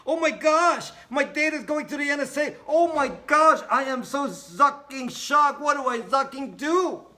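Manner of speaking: high, loud, hammed-up shocked voice; mock panic. Mocking a viewer shocked about the NSA, high hammed-up panic. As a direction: shriek it like a hysterical fan.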